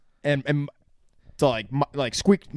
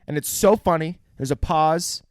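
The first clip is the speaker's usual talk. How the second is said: normal talking voice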